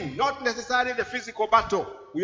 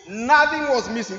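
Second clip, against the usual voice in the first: NOTHING hit louder at the start; emphatic stress; 3 semitones higher, 7 dB louder than usual. Real, not acted. Preacher stressing that all was recovered, emphatic stress on Nothing. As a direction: punch the word Nothing. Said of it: "This is put there for emphasis".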